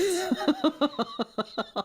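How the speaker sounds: real quiet laughter; amused laugh. Real, not acted. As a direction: chuckle lightly at your own retort. Laughs at own retort.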